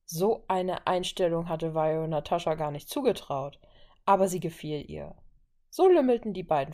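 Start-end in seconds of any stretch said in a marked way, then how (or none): none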